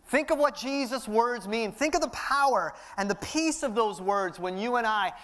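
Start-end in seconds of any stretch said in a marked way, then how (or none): none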